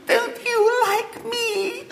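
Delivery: put-on high, slow, trembling girl's voice; a small girl on the verge of tears; quavering little girl. A narrator's reading. Little girl asks William if he likes her, quavering.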